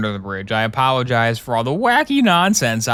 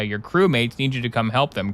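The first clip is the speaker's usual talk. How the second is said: ordinary talk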